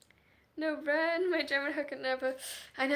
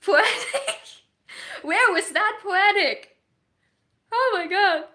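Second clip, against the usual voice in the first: laughing; 7 semitones higher, 11 dB louder, slower than usual. Real, not acted. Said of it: "Hannah pulling the poetic answers".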